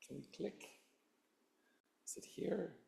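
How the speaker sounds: hoarse, breathless whisper off mic; raspy whisper. Real, not acted. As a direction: whisper through a failing voice. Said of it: "excuse my voice".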